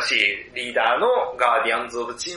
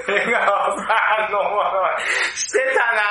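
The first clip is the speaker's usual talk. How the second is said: drawn-out, higher laughing wail; 6 semitones higher, slower than usual. Real, not acted. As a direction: laugh helplessly through slow, stretched words. Joking about impressions, laughing hard. (笑いながら声を張り上げて)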